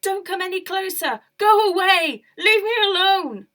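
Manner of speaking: terrified woman shouting at the monster; frightened shout. Acted. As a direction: scream at the monster to leave. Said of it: "She started to shout".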